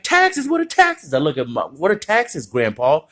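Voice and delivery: very high, squeaky disbelief; baffled kid. Retold or imitated. Kid asking grandpa about taxes, very high baffled squeak. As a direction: squeak it like you never heard the word.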